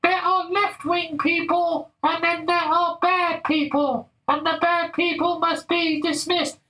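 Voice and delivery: very high, slow, sneering falsetto; mock the preachy elite in a squeaky voice; high mocking caricature of a scold; mocking lefty voice. Mocking the left's voice again.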